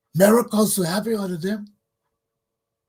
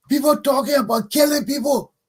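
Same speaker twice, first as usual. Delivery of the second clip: loud, higher outraged shout; 6 semitones higher, 4 dB louder than usual. Real, not acted. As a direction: blast it out in disbelief. Outraged rant.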